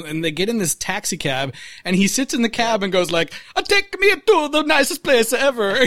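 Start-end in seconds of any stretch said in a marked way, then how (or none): 3.5-5.8 hammed-up French accent; fake French accent. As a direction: ask the driver in fake French. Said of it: "why do you have to talk with an accent".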